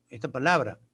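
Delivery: ordinary delivery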